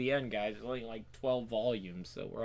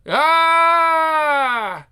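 loud, high, drawn-out "aah"; acted high-pitched holler; long scream; 15 dB louder than usual. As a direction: let out one sustained yell. Sudden cry in the comic reading.

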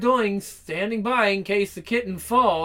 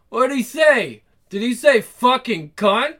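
ordinary talk, nothing marked

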